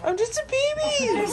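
put-on high, slow baby voice. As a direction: speak for the baby. Voicing the baby niece.

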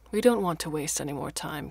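ordinary delivery

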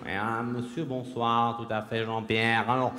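newsreader impersonation, measured and low; PPD. Acted. News anchor replies to his co-presenter, low measured imitation. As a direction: answer as the anchorman, slowly. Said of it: "P P D".

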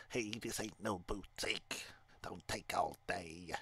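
slow, lightly voiced grumpy old shopkeeper; a hint of an old man hurrying a customer; slow old shopkeeper grumbling at a dawdling customer. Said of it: "He's gonna have an old man voice".

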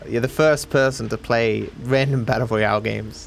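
ordinary talk

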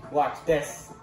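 ordinary talk, nothing marked

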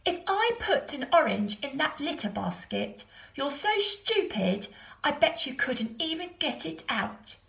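sing-song taunt at the goat; cheeky boy taunt. A narrator's reading.

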